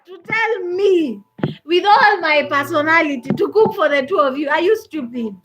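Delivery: loud, indignant telling-off; retold anger. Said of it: "I was very angry".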